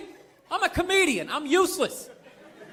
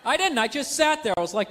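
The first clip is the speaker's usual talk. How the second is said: ordinary talk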